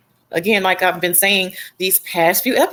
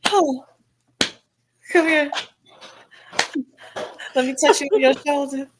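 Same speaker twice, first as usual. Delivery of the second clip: sly Kate; 5 semitones higher than usual. Retold or imitated. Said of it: "And Kate's like, slipping the glove off".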